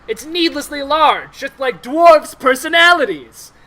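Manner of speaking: pointed insult. Acted. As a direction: build to a loud dig at the dwarves. Drow translator insults the dwarves.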